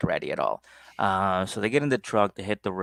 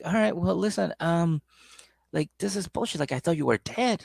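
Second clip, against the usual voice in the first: put-on high, exasperated girlfriend; annoyed girlfriend; 8 semitones higher than usual. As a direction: scold him in a high voice. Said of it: "she's like".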